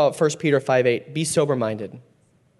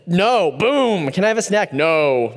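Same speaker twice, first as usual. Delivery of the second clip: quick, higher, louder no; curt mock refusal; 4 semitones higher, 3 dB louder than usual. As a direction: snap out a playful dad no. Dad mocking his own quick refusals.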